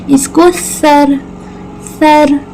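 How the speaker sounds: सर said slowly with a soft, tongue-out th sound; soft tha sound. Shown as a demonstration. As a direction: ease the first sound of सर out softly between the teeth. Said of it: "नरमी से अदा करेंगे". (सर में सा को नरमी से, ज़बान बाहर निकालकर)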